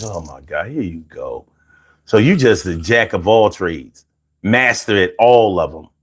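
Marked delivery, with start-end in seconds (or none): 0.0-1.4 a low, much softer "Oh my God, here you go"; weary eye-roll mutter; exasperated groan. Retold or imitated. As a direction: groan it like you've heard it all before. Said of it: "I be like".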